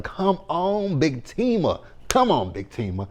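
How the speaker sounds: high, excited hype cheering. Retold or imitated. A fan cheering Fatima on while she stands up to Gary, excited. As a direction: hype up Big Tima from the couch.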